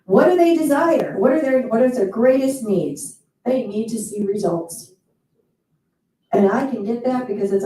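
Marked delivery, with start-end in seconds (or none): none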